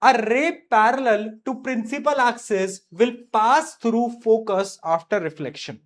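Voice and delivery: very slow, each word spaced for note-taking; slow dictation. Shown as a demonstration. Teacher dictating a physics rule to students, very slowly.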